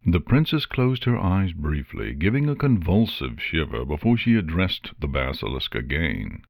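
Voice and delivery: ordinary delivery, nothing marked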